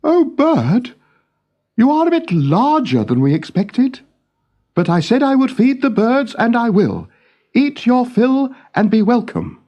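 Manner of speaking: put-on a young king fretting over his birds.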